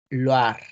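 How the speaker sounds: Loire said loar; French pronunciation. Shown as a demonstration. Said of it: "La región de Loira". (Loire pronunciado loar)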